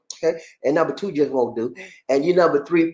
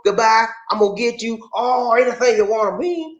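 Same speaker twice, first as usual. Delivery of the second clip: ordinary talk, nothing marked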